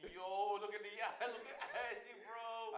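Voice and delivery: high, loud laughing exclamation; mock hype shouting. Filming a caught-out friend for Snapchat, loud mocking hype.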